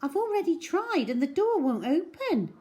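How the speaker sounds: put-on high girl's voice, matter-of-fact; bossy little girl explaining; know-it-all girl. A narrator's reading. Cherry explains the door.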